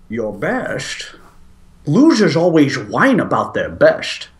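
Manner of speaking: a hint of a Scottish Sean Connery accent; low, slow Scottish drawl, lightly done; crappy Sean Connery accent. Quoting The Rock to his friend, a crappy Scottish accent. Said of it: "my crappy imitation accent of it".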